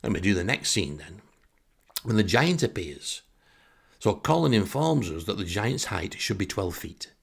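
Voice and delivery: usual speaking voice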